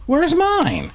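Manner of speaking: very high, pleading "mine". Pretend. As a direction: whine it like a left-out kid. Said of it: "repeats with higher pitch".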